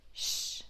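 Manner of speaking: "Sh" as a voiceless hush; just the consonant "Sh", no vowel; soft airy "Sh" without voice; sh sound. Shown as a demonstration. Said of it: "So it's sh sound".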